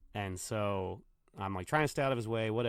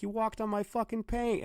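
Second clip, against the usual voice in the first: angry yell; 12 semitones higher, 3 dB louder than usual. Retold or imitated. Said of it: "he's like".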